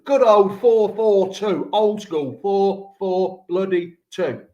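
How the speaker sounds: loud, drawn-out relish; relishing nostalgia. Real, not acted. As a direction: savour each number slowly and proudly. Hammed-up praise of old-school formation.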